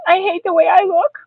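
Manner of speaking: high, loud plaintive wail; upset child's complaint; tearful self-pity. A narrator's reading. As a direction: wail it on the edge of tears. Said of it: "my eyes got tears in them".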